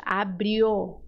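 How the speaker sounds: usual speaking voice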